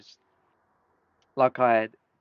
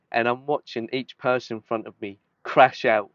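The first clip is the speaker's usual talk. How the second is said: normal talking voice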